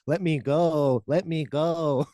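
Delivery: mock pleading, laughing through it; Stephen Hawking impression. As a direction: beg to go, half laughing. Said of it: "He does this fucking".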